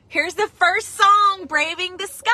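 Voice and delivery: excited announcement. Real, not acted. Announcing the first song.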